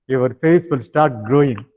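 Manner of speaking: ordinary talk, nothing marked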